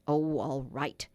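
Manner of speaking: slow, low, grudging surrender; low, drawn-out resignation; reluctant giving in. A narrator's reading. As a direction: give in reluctantly, dragging it out. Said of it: "he said at last, and gulped".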